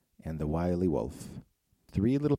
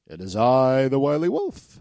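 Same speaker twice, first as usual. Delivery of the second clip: high, loud sly wolf; 6 semitones higher, 10 dB louder than usual. Acted. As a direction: announce yourself like a sly wolf. Wolf at third door.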